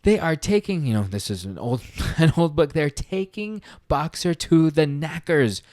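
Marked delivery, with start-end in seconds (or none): 0.0-0.8 high, panicked warning to the farm; frantic donkey pleading with the others; freaking out. Acted. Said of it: "he starts freaking out". 2.7-5.6 higher, slowed alarm; alarmed donkey warning the animals; freaking out. Acted. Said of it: "he starts freaking out".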